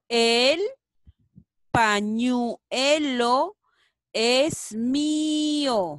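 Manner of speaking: loud and very slow; loud slow reading. Shown as a demonstration. Teacher reads the sentence aloud slowly for children. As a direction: stretch every word. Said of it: "¿Cuál será?".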